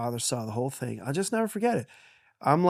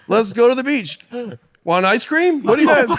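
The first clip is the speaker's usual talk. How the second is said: higher, louder, carefree dad voice; breezy cheerful father; 11 semitones higher, 11 dB louder than usual. Retold or imitated. Son imitating dad's cheery offer of ice cream, higher and louder. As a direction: toss it off like nothing happened.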